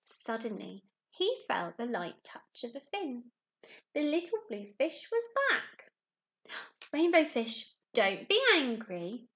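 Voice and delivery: lively storybook narration for little ones; animated picture book reading; reading a story to children. A narrator's reading. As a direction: tell it like a bedtime story to a class. Teacher reads picture book aloud.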